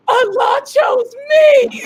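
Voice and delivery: slow, loud, sobbing cry. Real, not acted. Overwhelmed at the Kaaba, crying it out slowly and loudly. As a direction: let it break out through tears. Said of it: "I'm crying".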